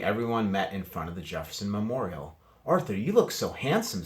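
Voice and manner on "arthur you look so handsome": put-on girl's voice. A narrator's reading. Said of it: "said Francine".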